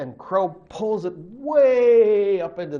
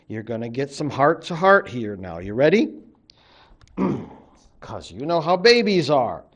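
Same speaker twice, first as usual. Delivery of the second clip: ordinary delivery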